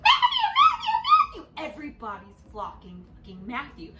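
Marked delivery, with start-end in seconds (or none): 0.0-1.7 high, slow squealing fan cry of a name; screaming fangirls. Retold or imitated. Girls mobbing Matthew outside the theater, high fangirl shriek. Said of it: "all the girls are just like".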